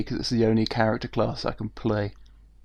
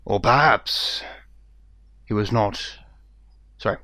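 quieter, grave and measured; serious character voice; 3 semitones lower, 5 dB quieter than usual. Acted. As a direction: drop into a sober, serious tone. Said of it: "becoming a totally different character and significantly more serious".

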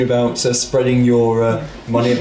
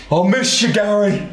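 tearful; 6 semitones higher than usual. Acted. Grieving NPC over dead poodle.

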